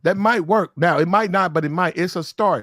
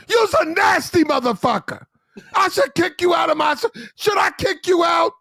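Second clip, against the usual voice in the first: mock officer yelling; 12 semitones higher than usual. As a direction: bellow at the sailor like a furious lieutenant. Lieutenant shouting at sailor.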